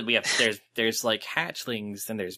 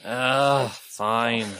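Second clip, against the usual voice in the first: grudging; 6 dB louder than usual. Acted. Grudging reluctant agreement.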